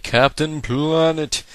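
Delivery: high, loud, slow mock superhero announcement; mock hero call. Gamer voices an opponent's move choice as a hammed-up hero call. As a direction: stretch it out like a cartoon hero's name. Mimics trainer's battle thinking.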